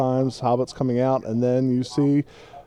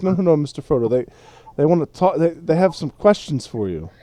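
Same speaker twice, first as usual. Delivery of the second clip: lightly voiced, higher servant's plea; pleading hobbit servant; 5 semitones higher, 4 dB louder, faster than usual. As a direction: coax your tipsy master back. Sam steering drunk Frodo back.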